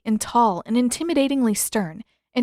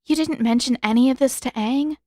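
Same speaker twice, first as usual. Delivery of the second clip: usual speaking voice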